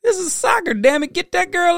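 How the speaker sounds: exasperated outburst. Retold or imitated. Spectator at a soccer game urging someone to help, exasperated. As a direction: plead for someone to help the girl. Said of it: "I was like".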